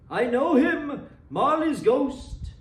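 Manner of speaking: high, loud, slow cry of alarm; the flame crying out in warning; cried out. Acted. Dying fire flame crying out as Marley's ghost enters, high slow cry. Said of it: "as though it cried".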